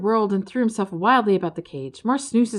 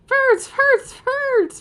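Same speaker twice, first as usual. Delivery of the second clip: very high, loud gleeful shouts; yelling with glee; 7 dB louder, much slower than usual. Acted. Ferd jumping around shouting gleefully at the Ferds. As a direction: shout for pure joy. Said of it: "jumping around and yelling with glee".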